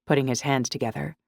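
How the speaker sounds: ordinary delivery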